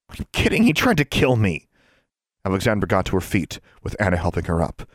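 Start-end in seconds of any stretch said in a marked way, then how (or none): 0.1-1.7 indignant. A narrator's reading. Indignant reply after attack.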